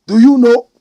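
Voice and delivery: loud, high demand; challenging listeners loudly; shouted challenge. Acted. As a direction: shout the question at them.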